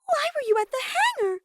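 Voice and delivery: put-on high girlish voice asking why; a curious high-pitched demand; Sumugi's high voice. A narrator's reading. Sumugi asks Himiko about the hangar, high and girlish. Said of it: "Sumugi asked her".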